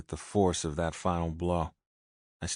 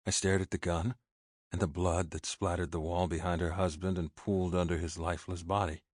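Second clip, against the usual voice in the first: plain reading voice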